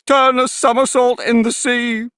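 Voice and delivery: high, loud cry; wild cry. A narrator's reading. Mock Turtle capers wildly about, crying out high and loud. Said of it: "cried the Mock Turtle".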